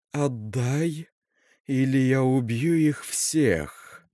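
медленный зловещий хрип; тяни слова хрипло и угрожающе; хриплый голос. Acted. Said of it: "хриплым голосом сказала София". (хрипло, зловеще, медленно)

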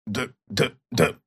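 low-volume, malicious muttering; quiet, venomous agreement; spiteful. A narrator's reading. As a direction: repeat it softly with spite. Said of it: "заговорил Персиков злобно".

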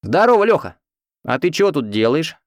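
loud, high hail from afar; calling out. Acted. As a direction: call out across the station. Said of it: "меня окликнули".

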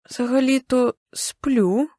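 slow, drawn-out, taken-aback reply; shocked. A narrator's reading. Woken woman answers a strange man, shocked. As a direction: stretch it out in sleepy disbelief. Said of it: "відповідаю шоковано".

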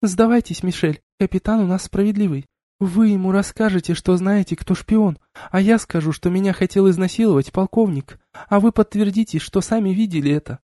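put-on high, bright-eyed girlish voice; cheerful girl. A narrator's reading. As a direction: coax him with a smile. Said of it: "Лиранда радостно заулыбалась".

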